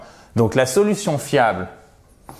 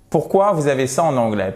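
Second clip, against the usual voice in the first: usual speaking voice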